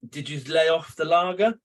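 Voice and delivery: normal talking voice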